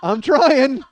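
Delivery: loud, high protest; retold exasperated shout. Son telling mom on the phone he's trying, exasperated. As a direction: burst out in mock exasperation.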